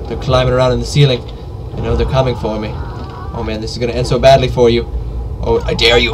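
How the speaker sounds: normal talking voice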